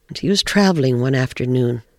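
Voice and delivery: plain reading voice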